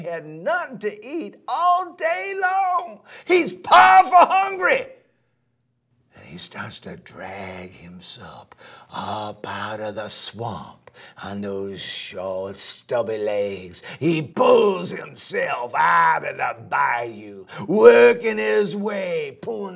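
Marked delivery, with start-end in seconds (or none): 6.2-13.8 hushed suspense. A narrator's reading. Gator drags himself out, suspense.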